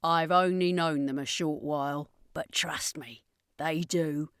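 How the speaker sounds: a hint of a different voice for Carleen, calm; lightly voiced companion reassuring a doubter.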